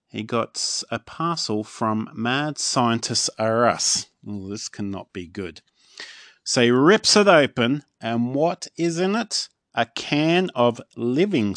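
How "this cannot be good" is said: plain reading voice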